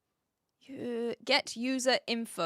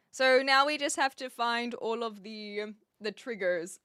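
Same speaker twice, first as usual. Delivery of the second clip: ordinary talk, nothing marked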